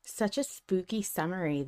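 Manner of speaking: ordinary talk, nothing marked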